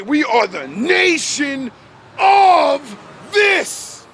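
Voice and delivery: slow, rising into a high proud shout; proclaiming shout. Retold or imitated. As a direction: declare it like a rally cry. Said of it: "We say".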